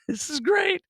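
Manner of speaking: high-pitched laugh through the words; laughing delight. Real, not acted. Vlogger enjoying the pile-up, laughing high.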